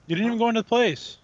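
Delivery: high, loud disbelief; exasperated shout. Real, not acted. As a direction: yell it in frustration. Exasperated outburst.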